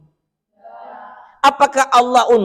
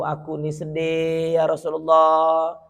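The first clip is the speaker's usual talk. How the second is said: a weeping stone begging for prayer, low and soft; a lightly voiced sad stone; 5 semitones lower, 3 dB quieter than usual. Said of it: "Kata batu".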